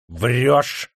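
sharp furious bark; angry shout. A narrator's reading. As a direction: shout it at him. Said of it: "ударил кулаком по столу".

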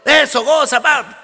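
party cheer. Retold or imitated.

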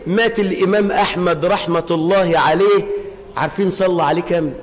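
ordinary delivery

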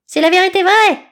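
very high, loud chirp; squeaking. A narrator's reading. Eaglet squeals at the snake. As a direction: peep it out shrilly. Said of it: "piailla Aiglon".

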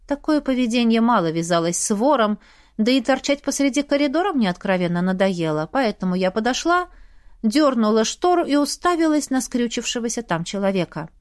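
ordinary delivery

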